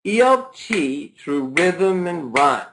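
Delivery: "E L T Through Rhythm and Rhyme" slowed right down, leaning on each stressed syllable; slow, measured beat on "E L T Through Rhythm and Rhyme"; stress-timed beat. Shown as a demonstration. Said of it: "not intonation as much as it's stress".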